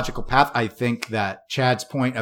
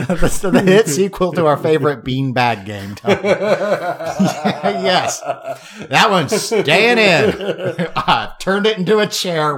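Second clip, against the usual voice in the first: talking through laughter, voice pitched up; laughing; 5 semitones higher, slower than usual. Real, not acted. Podcast host cracking up over a beanbag game joke, laughing. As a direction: crack up while getting the words out.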